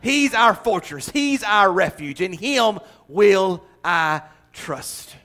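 high, loud, slow preaching; preaching at full force. Real, not acted. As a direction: proclaim it with rising fervour.

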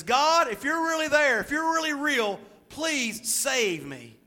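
high, loud plea up to God; acted desperate yell. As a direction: call up for rescue, slowing on the last words. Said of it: "he yells up there".

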